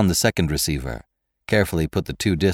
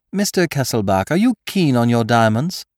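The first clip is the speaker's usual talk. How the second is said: lightly acted teasing thief, pitched up; Lupin bargaining; 6 semitones higher, 4 dB louder than usual. A narrator's reading. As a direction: dangle an offer before your prisoner. Lupin bargains with his captive.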